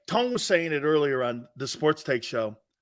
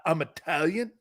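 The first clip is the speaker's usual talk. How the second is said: ordinary delivery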